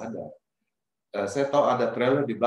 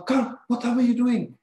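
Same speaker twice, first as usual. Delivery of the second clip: high, loud, startled cry at the passenger; shocked outcry; 12 semitones higher, 5 dB louder than usual. Retold or imitated. Driver yelling at the passenger who just hit him, shocked. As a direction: recoil and yell what are you doing. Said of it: "Saya bilang".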